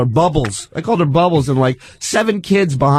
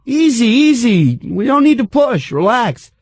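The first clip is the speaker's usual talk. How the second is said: retold protest; 8 semitones higher than usual. Telling the woman elbowing him on the train, exasperated protest. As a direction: tell a pusher to ease up, pitched up. Retold standoff with pushing passenger.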